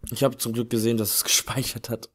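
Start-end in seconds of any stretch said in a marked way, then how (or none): none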